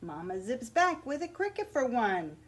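put-on lively picture-book read-aloud for children; story narration. A narrator's reading. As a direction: read it like story time at the library.

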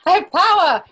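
POWER pitched high and loud, triumphant; gleeful boast. Real, not acted. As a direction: crow it like you've seized the throne. Host gains control of Zoom.

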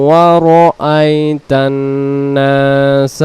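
slow melodic chant, no breath between words; unbroken recitation. Shown as a demonstration. Teacher reciting without pausing for breath, chanted slowly. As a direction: carry straight into the next word. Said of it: "দমটা না ছেড়ে দিয়ে পরের শব্দে".

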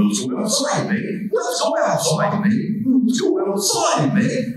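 high Goofy lines said again and again; varied Goofy retakes; repeated Goofy takes. Shown as a demonstration. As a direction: cycle through takes of the same line. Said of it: "you do it over and over".

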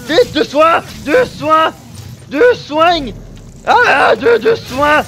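high, loud desperate repeated calls; frantic yelling under attack; panicked shouting. Real, not acted. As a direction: scream urgently for healing. Desperate for healing in fight.

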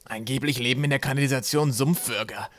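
slightly strained, muffled character voice. A narrator's reading. Trapp warns of sewer creatures, muffled voice. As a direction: speak as if squashed underneath. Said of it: "mit gedämpfter Stimme".

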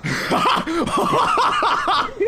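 high, drawn-out burst of laughing; real laughter. Real, not acted. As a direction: crack up at a friend's silly accent. Cracks up at the accent bit.

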